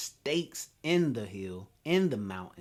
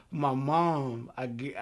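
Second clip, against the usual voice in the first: normal talking voice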